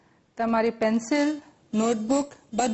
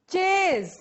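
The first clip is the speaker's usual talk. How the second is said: raised, drawn-out word for repeating; loud model word; 4 semitones higher, much slower than usual. Shown as a demonstration. Teacher leading students in repetition, called out. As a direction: call it out higher for a class to echo. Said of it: "મોટેથી બોલજો".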